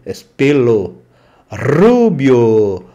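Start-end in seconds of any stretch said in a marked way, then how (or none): 1.5-2.8 rubio with a trilled rr; teacher stressing the vibrating R; strong rolled R. Shown as a demonstration. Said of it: "Pronúncia forte, vibrante".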